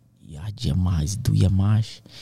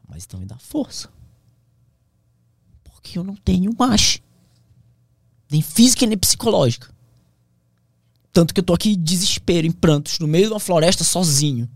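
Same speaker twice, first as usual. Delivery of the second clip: pleading to God, raised and high; desperate plea; 7 semitones higher, 3 dB louder than usual. Retold or imitated. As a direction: cry out for strength, voice lifted. (súplica desesperada em voz alta)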